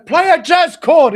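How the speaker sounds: hammed-up heckle called from the crowd; mock heckler shout. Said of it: "it goes and goes".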